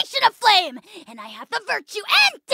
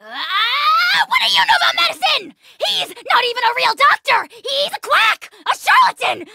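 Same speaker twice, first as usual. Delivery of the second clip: indignant shout; 8 semitones higher than usual. Acted. Ahau mocking the vet who cured him, indignant shouting. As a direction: shout down the quack in outrage.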